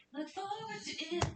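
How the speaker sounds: high lilting "Look forward to it" with a falling end; sing-song tease. Shown as a demonstration. Streamer teasing fans about the collab, high sing-song.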